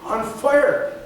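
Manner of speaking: loud, high, emphatic preacher delivery; impassioned preaching. Real, not acted. Preacher tells the congregation their passion stays on fire, impassioned. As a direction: preach it with force, drawing out key words.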